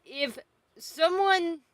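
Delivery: ordinary delivery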